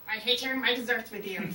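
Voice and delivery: loud, sudden outburst; blurted out. Retold or imitated. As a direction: let the words burst out unfiltered. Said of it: "blurt out".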